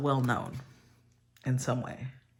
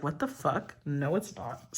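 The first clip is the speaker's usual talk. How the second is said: softer, flat-voiced what the fuck, muttered; under the breath, incredulous then dismissive; a quiet retort to herself; quiet disbelief; 3 semitones lower, 7 dB quieter, slower than usual. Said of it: "And I was like".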